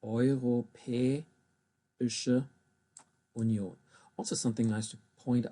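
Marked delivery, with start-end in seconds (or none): 0.0-3.8 "Europäische" drawn out slowly with "eu" as oi, then "Union" apart with a falling tune; slow separated syllables. Shown as a demonstration. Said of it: "I'm gonna say it again".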